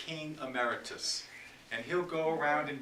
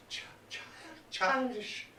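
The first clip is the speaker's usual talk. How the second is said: breaking into treble; 11 semitones higher than usual. Acted. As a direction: voice cracks up into a childish squeak.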